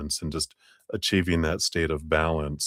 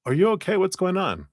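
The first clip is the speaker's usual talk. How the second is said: much higher, lightly caring check-in; a sweet curious concern, voiced up high; mock gentle concern; 12 semitones higher than usual. Curious coworker asking if the other is okay, high gentle concern. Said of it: "I can get curious and go".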